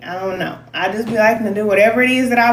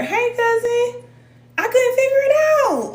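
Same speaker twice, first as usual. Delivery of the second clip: high sing-song greeting. Real, not acted. Greeting her cousins in a very high playful voice. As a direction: squeal a playful hello to a cousin.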